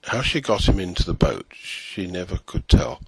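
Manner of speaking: ordinary delivery, nothing marked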